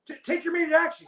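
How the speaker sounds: high, fast stuttered order; stuttering panic. Retold or imitated. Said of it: "he's stuttering and he's like".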